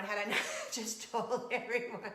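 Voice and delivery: real embarrassed giggling; laughing confession. Real, not acted. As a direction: laugh through the admission. Laughing at her own confession.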